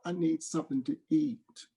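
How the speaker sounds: put-on high pleading little child's voice; crying child. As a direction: beg like a hungry small kid. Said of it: "Baby began to cry".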